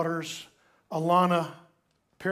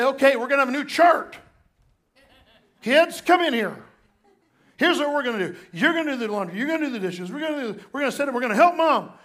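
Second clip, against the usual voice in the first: higher, louder take-charge husband giving orders; bossy fix-it husband; 7 semitones higher, 7 dB louder, faster than usual. Retold or imitated. Pastor imitating himself organizing the kids, bossy. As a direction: play yourself barking out a chore plan. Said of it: "And I would say".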